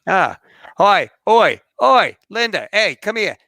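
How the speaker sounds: high, loud mock hollering in Kiwi accent; imitated New Zealander shouting "oi"; calling someone over. Skydive staff call Linda over, imitated holler.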